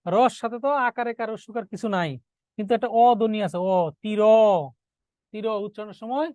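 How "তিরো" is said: "তির" said as "tiro", final o drawn out; audible o ending. Shown as a demonstration. Teacher showing students the hidden vowel, "তির" with a clear long o. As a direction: sound the o at the end of "তির". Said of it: "অ ধ্বনির উচ্চারণ করতে হয়".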